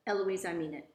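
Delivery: a contained shout of reprimand; mild narrated shout. A narrator's reading. Tutor losing patience with Eloise, mild shout. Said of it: "And then he shouts".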